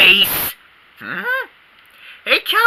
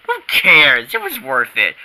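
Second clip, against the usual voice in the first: ordinary delivery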